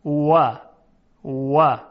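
و said with rounded lips, a w not a v; Arabic waw demo. Shown as a demonstration. Teacher contrasting Arabic waw for students, rounded lips.

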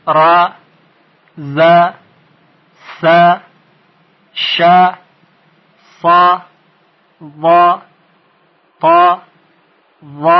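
ر، ز، س each a separate short "a" syllable; short fatha letters. Shown as a demonstration. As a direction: sound each letter with fatha and stop it promptly.

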